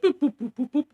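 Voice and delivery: high sing-song beep boop; mock computer beeps. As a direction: chirp it like a scanning machine. Imitating facial recognition computer.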